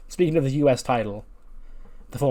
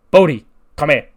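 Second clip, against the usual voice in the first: loud, higher-pitched summons; calling out; 4 semitones higher, 14 dB louder than usual. Acted. Teacher summoning his student Bodhi across the bar, calling out. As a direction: call the student over loudly.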